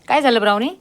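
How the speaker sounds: high and loud, quick question to the dog; brisk high call to the dog; quick pet call. Real, not acted. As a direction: call out to her sharply but kindly. Owner asks crying dog.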